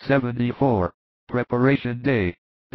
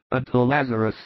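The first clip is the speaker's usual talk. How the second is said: ordinary delivery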